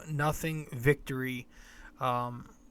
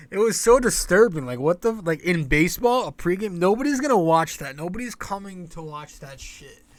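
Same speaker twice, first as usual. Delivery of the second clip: outraged rant; 4 semitones higher, 10 dB louder than usual. Real, not acted. Outraged rant about ceremony.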